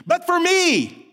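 me cried out high and loud, slow; soaring declaration. Shown as a demonstration. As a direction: lift me to a peak. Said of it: "the writer declares".

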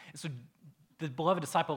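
normal talking voice

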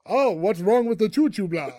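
a light hint of a vampire count. Count asks about the broken train in a light vampire voice. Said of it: "Count Fly's like".

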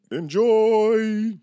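high, booming radio announcer. Acted. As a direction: belt it out like a DJ. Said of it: "I'm working on my voiceover work".

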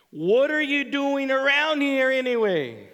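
brother's angry demand, pitched high and loud. Retold or imitated. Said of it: "he demanded".

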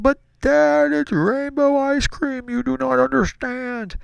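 put-on very high, drawn-out kid whine; mock-crying, slow high pleading; imitated tearful protest. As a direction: wail at Dad like a desperate child. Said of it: "partially in tears at this point".

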